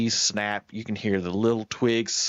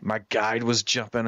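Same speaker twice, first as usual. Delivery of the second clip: ordinary delivery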